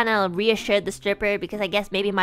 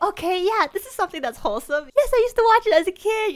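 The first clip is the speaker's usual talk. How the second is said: high, fast, eager babble; giddy nervous answer; 10 semitones higher, faster than usual. Retold or imitated. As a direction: rush it out brightly like a relieved kid. Coping, excitedly answering wrong thing.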